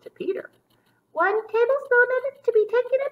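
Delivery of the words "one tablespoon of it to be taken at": high, louder mock motherly voice; primly dose out the medicine; a fussy mother rabbit reading the label; mother's instruction. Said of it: "she gave a dose of it".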